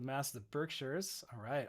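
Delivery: normal talking voice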